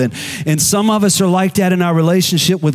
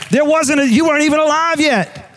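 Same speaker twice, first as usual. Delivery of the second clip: ordinary talk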